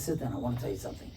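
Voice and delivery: calm him with a hushed word; soft and hushed. Retold or imitated. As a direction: owner settling the father down, soft and quick. Owner speaks gently.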